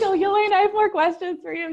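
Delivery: high, loud singsong call; playful pestering. Retold or imitated. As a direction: sheepishly hail a helper in a comic high voice. Acts out pestering nurse on phone.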